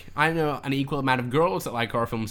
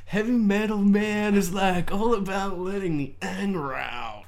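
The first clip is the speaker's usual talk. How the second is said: laid-back stoner hippie drawl, higher; 5 semitones higher, slower than usual. Retold or imitated. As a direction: mellow hippie, man. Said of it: "like, a hippie voice".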